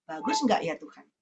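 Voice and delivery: a hushed aside to God; asking God softly. Retold or imitated. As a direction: murmur the question up to God. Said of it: "Saya mesti tanya Tuhan".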